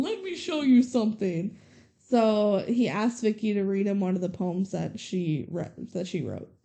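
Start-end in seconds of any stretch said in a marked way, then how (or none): 0.0-1.6 hammed-up grandpa trope voice; mock movie grandpa. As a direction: play the grandpa cliché with a knowing wink. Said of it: "the same trope of Grandpa going".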